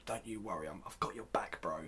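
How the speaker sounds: hushed, voiceless aside to the listener; mock whisper. Whispering to the listeners that the Witchers get their own episode. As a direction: lean in and whisper it like a secret.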